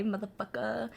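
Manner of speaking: soft "motherfucker" ending in uh; smooth, dropped R motherfucker. Shown as a demonstration. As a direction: say "motherfucker" smoothly without the final R. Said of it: "It's kinda smooth".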